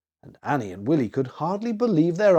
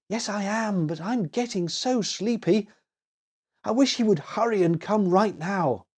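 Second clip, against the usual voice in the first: lightly voiced sleepy girl, raised a bit higher; a small girl fighting sleep, a touch higher; sleepy little girl; 7 semitones higher than usual. A narrator's reading. Annie answers her brother in bed, a lightly voiced sleepy girl.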